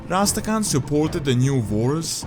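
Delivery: usual speaking voice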